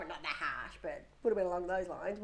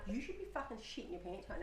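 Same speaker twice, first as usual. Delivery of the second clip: aside; 11 dB quieter than usual. Retold or imitated. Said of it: "I'm like".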